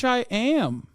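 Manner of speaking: usual speaking voice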